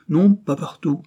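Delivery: ordinary delivery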